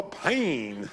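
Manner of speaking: drawn-out Southern "Pain", vowel stretched long and dropping; southern drawl. Retold or imitated. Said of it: "in the southern drawl".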